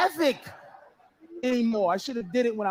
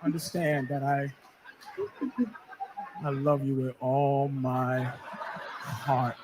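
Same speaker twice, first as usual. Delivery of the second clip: much lower, slow mock sobbing; lower, slower fake weeping declaration; hammed-up crying; 10 semitones lower, 5 dB quieter than usual. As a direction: sob out a mock farewell. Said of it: "She's crying".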